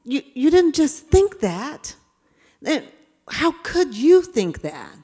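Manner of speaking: appalled inner scolding; self-reproach. Retold or imitated. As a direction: demand it of yourself, slowing at the end. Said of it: "I'm like".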